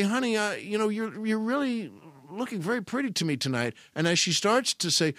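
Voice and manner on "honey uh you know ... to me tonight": higher, softer, coaxing compliment; crooning flirty husband; mock sweet-talk. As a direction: sweet-talk your wife. Flirting with wife, suggested.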